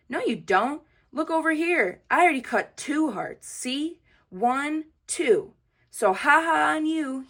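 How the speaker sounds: taunting brag. A narrator's reading. Jim taunts Junie.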